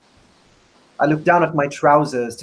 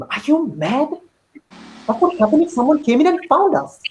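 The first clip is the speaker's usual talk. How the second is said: much higher, panicked hush-up; alarmed protest; 12 semitones higher than usual. A narrator's reading. Boy pulling away from Shehan, alarmed and raised. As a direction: pull away and hiss the question high.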